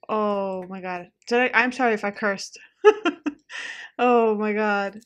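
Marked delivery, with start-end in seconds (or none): 2.8-3.9 high nervous laugh; relieved laugh. Real, not acted. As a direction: laugh off the scare. Relief after the scare.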